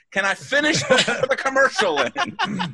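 high, slow, laughing plea. Retold or imitated. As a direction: ask it while cracking up. Same question, laughing and higher.

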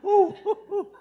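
high laughing woo; laughing whoop. Real, not acted. Laughing after joke about wife.

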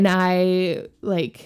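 ordinary talk, nothing marked

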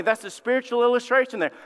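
ordinary delivery

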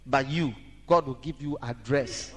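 ordinary talk

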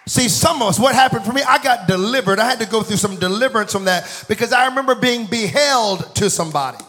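high, loud, impassioned sermon delivery; fervent shouted preaching; full-force preaching. Real, not acted. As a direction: drive the point home to the whole congregation.

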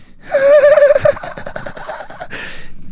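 high mock cackle, swelling; piercingly high staccato giggle; imitated laughter. Friends' laughing haunts him, high relentless cackle. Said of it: "Fuck, stop laughing".

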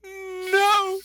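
very high, loud squealed no; first no. Retold or imitated. Showing the Ace Ventura-like first no to his friend, squealed high. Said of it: "The first one's like".

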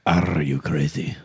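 slow "Are" with a trilled R; rolled R. Shown as a demonstration. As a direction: roll the R hard at the start of "Are". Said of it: "Can you roll your R's?".

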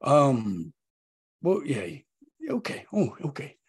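tearful, halting; fighting back tears; choked up. Acted. Dwarf overcome when gift moment comes.

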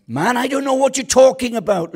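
high, loud brush-off; Peter's denial. Retold or imitated. Preacher voicing Peter denying the girl. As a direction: snap out the denial.

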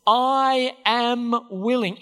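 I am willing, drawn out slowly; slow gentle Jesus. Retold or imitated. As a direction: speak it slow and tender as Jesus. Jesus answers leper, slow.

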